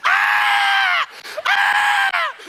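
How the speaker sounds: shrill scream pitched very high and loud; mock girly scream. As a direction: squeal like an overexcited friend. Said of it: "look for a reason to scream out".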